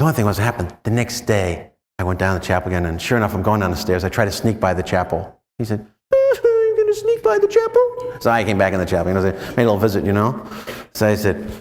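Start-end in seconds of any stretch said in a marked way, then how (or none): 6.1-8.2 very high, tiny puppet-show voice; squeaky puppet Jesus. Retold or imitated. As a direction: voice a little whining Jesus like a hand puppet. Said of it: "Sound like one of those puppet shows".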